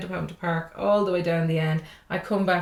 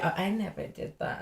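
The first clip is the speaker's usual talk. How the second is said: softer, airy fake-innocent claim; mock innocent denial; 10 dB quieter than usual. Singer mocks people who claim innocence, softer and fake-innocent. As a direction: play the hypocrite denying it lightly. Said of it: "it's easy to say".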